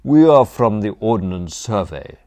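loud, official announcement; shouting. A narrator's reading. Butler bluffing distant dons, shouting officially. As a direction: project it across the lawn. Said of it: "he shouted".

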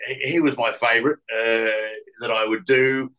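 usual speaking voice

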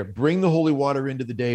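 usual speaking voice

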